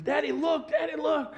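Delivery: put-on high excited child voice; high, eager little kid calling daddy; little son's voice. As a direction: play the small boy showing his rock. Child voice quoted in story.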